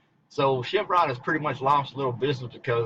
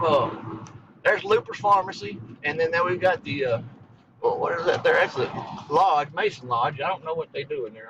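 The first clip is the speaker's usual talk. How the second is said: ordinary talk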